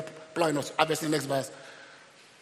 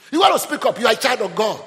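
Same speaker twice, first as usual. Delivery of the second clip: raised, punchy urging; forceful exhortation; 5 semitones higher, 7 dB louder than usual. Real, not acted. Preacher telling a child of God to speak up, forceful exhortation. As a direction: push the listeners to speak up, loudly.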